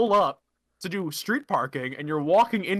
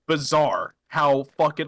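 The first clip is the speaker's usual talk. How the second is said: ordinary delivery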